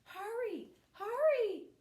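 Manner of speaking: high, loud urgent calling; high loud anxious call. Acted. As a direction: shout for him to run home. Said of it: "she called".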